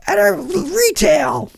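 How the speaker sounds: high, slow hammed-up wail; mock panicked wail. Gamer spooked by retail crates, mock panicked wail. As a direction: wail it like a horror victim, half joking. Hammed-up horror reaction in game.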